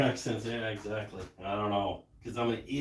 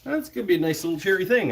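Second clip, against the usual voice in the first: excited; 7 semitones higher, 5 dB louder, much faster than usual. Real, not acted. Pleased with the wood.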